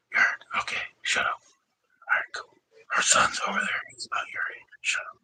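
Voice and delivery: secretive whisper, no voice at all. Real, not acted. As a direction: whisper it so her son can't hear. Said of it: "I'm whispering to the other dude".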